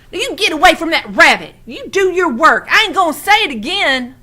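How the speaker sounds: loud, high, fast scolding. A narrator's reading. Old woman orders boy back to work, loud high scold. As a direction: lay down the law sharply.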